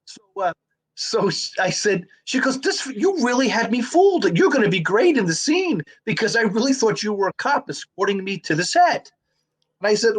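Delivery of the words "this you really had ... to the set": higher-pitched impressed gushing; retold excited praise. Co-star fooled by the actor, higher-pitched retold praise. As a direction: imitate an impressed colleague, pitch lifted.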